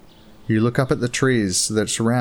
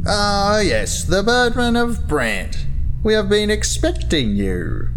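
high, slow, drawn-out villainous greeting; put-on voice of a sinister welcomer; ominous man with dog; 11 semitones higher, slower than usual. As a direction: stretch the words out high, as a menacing welcome.

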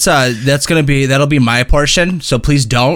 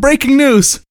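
higher, dramatic anchor call; hammed-up newsreader; mock announcer; 11 semitones higher than usual. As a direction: announce it like TV news. Announcing after jingle.

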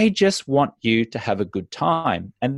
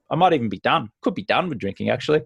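usual speaking voice

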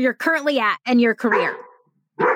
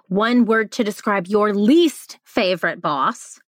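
ordinary delivery

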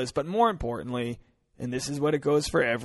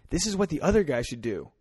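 ordinary talk

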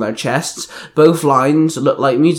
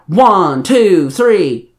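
louder, higher count-in; called-out count-in; 5 semitones higher, 5 dB louder, much slower than usual. A narrator's reading. Drummer counts the team in, calling out. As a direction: count the band in with a call. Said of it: "Indra called out".

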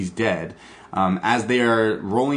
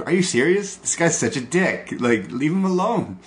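higher, mock-indignant outburst at the screen; outraged disbelief; 6 semitones higher than usual. Fan reacting to a surprise attack, indignant. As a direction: scold the villain as if he could hear you. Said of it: "'cause I'm like".

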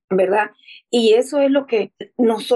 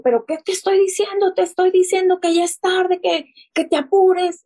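higher, insistent scolding; angry mother scolding; 9 semitones higher than usual. Retold or imitated. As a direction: nag the boy sharply to hurry. Retold mother scolding son. (regaño de madre enojada, tono alto)

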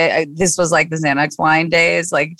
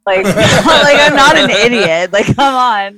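laughing; 4 semitones higher, 5 dB louder, slower than usual. Real, not acted. Laughing through indignant rant.

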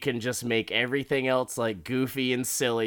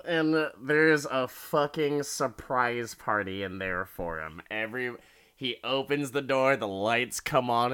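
ordinary talk

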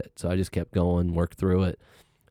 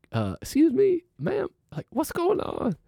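very high, meek, hesitant asking; timid question. Retold or imitated. Confused patient timidly asking the nurse what is happening. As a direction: ask politely like you're afraid of the answer.

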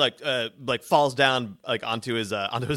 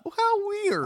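mock surprise. Kid mocking alien.

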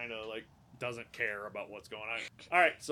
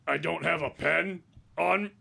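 goblin chief; 4 semitones higher, 8 dB louder than usual. Acted.

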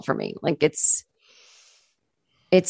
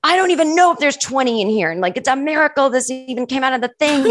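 raised, fed-up complaint; exasperated retort; 7 semitones higher than usual. Retold or imitated. Telling a friend about her account, higher and fed up. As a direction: vent that there's barely any money. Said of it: "And I was like".

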